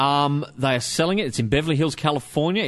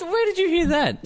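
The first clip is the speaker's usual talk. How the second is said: amused disbelief; slower than usual. Real, not acted. Still amused by the joke.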